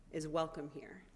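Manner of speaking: hushed, gentle "welcome here"; soft welcome. Acted. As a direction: murmur the welcome softly. Quiet welcome in meditation.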